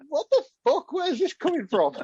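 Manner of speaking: high-pitched comic bewilderment; baffled outburst. Retold or imitated. Co-host reacting to the sudden accent change, baffled outburst. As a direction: let the voice leap up in mock disbelief.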